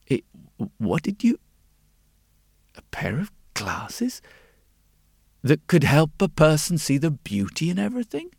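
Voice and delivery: halting, disbelieving question with long gaps; stunned. A narrator's reading. Glasses maker hearing an impossible request, stunned. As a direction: grope for the words in astonishment. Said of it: "the glasses maker was stunned".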